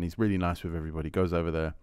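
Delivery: normal talking voice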